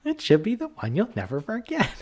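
high mock flight attendant voice; imitating flight attendant. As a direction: lightly parrot the cabin announcement. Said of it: "the steward voice is back".

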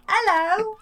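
high, playful cartoon greeting; talking cupboard. Haunted cupboard greets the family, silly high voice. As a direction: pipe up like enchanted furniture come to life. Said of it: "cupboard opened its eyes and was like".

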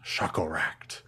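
low spooky whisper. Pretend. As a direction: breathe the name like a dark secret. Echoes the villain name ominously.

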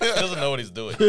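laughing while imitating a doubter; chuckling through the words.

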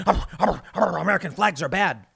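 cartoon snarl, a mock angry grunt; mock growling angry activist; growling mock extremist. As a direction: growl it like a cave-man caricature. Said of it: "see someone going".

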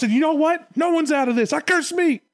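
very high, hammed-up tantrum; mock hysterical exasperation; tantrum-throwing God. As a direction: declare it all in a high, fed-up wail. Acted God's tantrum cursing himself.

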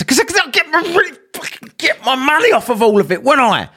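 high-pitched sarcastic snap; mock her obviously; exasperated comeback; sarcastic retort.

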